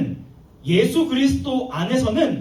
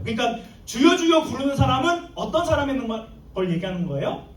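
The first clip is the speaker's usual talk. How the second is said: usual speaking voice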